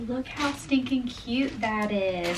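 gushing delight. Real, not acted. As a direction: draw it out like admiring something adorable. Showing off the next sample.

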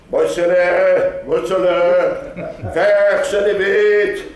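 loud, drawn-out mussel call; street cry. Acted. (luide straatroep van mosselverkopers)

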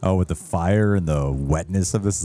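normal talking voice

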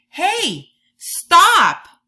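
loud, high shout to stop a bully; brave shout. Acted. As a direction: yell it out to stop them. Brave child stops someone hurting another.

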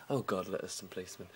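normal talking voice

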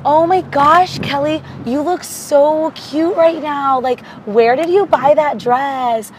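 high, slow gushing compliment. Pretend. Amy gushing over Kelly's dress, high and slow. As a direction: gush over her friend's dress.

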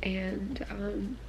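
hushed, emotional whisper, close to tears. Real, not acted. Whispering to viewers about moving west, emotional. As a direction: confide a dream in a near-whisper.

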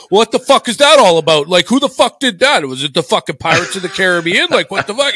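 loud, high, going off; imitated angry rant. Co-host blaming everybody on a call, imitated angry rant. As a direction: fire off mock-outraged questions at high pitch. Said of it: "he's going off".

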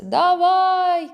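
long high call across a field; shouting. A narrator's reading. Boy calling his friend lost in the corn, shouting. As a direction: call out loud, drawing out the vowels. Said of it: "кричу".

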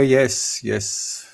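usual speaking voice

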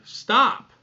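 sharp yelled stop; shouted command. Retold or imitated. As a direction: bark it at the attacker. Shouting at a threat.

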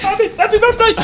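shrieking high with laughter; laughing birthday cheer. Acted. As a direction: squeal it through laughs. Mock birthday cheer while laughing.

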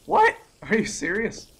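high, loud incredulous what; shocked squeak. Real, not acted. Gamer reads a silly mob name, squeaking in disbelief.

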